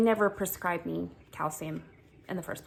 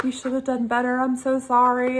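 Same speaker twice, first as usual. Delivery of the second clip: apologetic pharmacist; 5 semitones higher, 4 dB louder than usual. Retold or imitated. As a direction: plead sorry like a rattled pharmacist.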